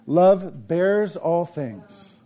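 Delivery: each word drawn out slowly; slow emphatic preaching. Acted. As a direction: lean hard on every word, slow. Preaching repeated verse.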